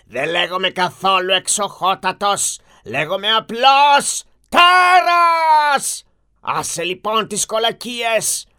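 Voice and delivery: a beast roaring his name and terms; angry monster. Acted. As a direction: beast demands a daughter as sacrifice, roaring. Beast answers again.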